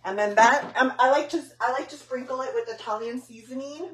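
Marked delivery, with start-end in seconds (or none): none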